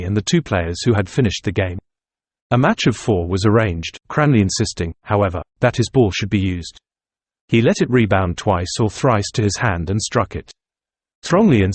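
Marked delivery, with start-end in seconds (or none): none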